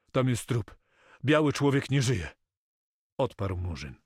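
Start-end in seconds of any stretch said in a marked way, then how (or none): 0.1-2.3 higher, louder urgent announcement; alarmed report. A narrator's reading. Frightened Moko reports a dead man to Carmo, alarmed. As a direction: break the grim news urgently.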